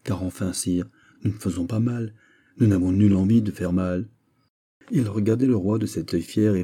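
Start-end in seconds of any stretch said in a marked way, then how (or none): none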